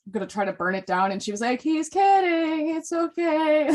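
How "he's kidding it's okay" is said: higher, slow, drawn-out mock reassurance; smooth it over in a high, stretched voice; mock placating. Retelling her covering for the torch-grabber, mock placating. Group's peacekeeper covering for an arsonist.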